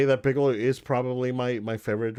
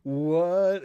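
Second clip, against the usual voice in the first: high, drawn-out mock scream of disbelief; retold scream; 7 semitones higher than usual. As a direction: replay a stunned scream, pitched high. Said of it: "I was screaming".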